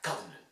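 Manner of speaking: "Government" with stressed GUV, weak ending; only ga heard. Shown as a demonstration. Teacher repeats the model, "Government" reduced after GUV. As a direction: reduce everything after the first syllable of "Government". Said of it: "You only hear ga".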